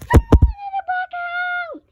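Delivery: very high, loud doll shout; excited shout. Pretend. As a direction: call it out thrilled.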